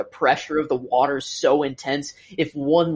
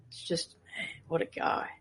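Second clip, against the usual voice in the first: whispered; 3 semitones higher, 11 dB quieter than usual. Real, not acted. Whispered awe.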